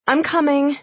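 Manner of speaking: high-pitched answering call; calling back. A narrator's reading. Emily answering Frida's hurrying, calling back.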